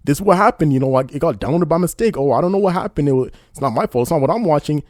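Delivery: hammed-up innocent explaining; mocking excuse-maker. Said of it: "explaining a little bit".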